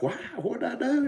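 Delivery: squeaky mock innocence, what did I do; high confused whine. Recalling being warned off by a friend, in a high baffled squeak. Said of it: "And I'm like".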